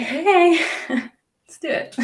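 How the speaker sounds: high, loud eager Hey, then laughing; eager yes. Retold or imitated. Guest acting her keen reply to a fasting challenge, laughing. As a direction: jump at the offer, laughing. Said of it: "I'll be like".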